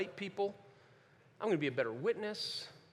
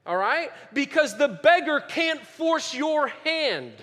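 loud, raised preaching voice, phrases stretched out; eindringliches Predigen; 8 semitones higher, 8 dB louder than usual. Real, not acted. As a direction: preach it forcefully, high and loud. Preacher drives home beggar illustration.

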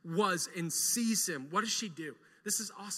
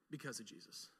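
even softer, lower echo; soft repeat; 7 semitones lower, 17 dB quieter than usual. Real, not acted. Preacher echoing the answer to the congregation, hushed. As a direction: repeat it barely above a breath.

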